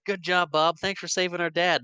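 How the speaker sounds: high-pitched imitation of admiring family; chirpy put-on congratulations; grateful family. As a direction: gush thanks in mock praise. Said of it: "the family's like".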